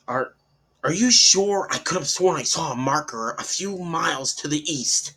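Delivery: arguing. Acted. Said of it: "Joey argued".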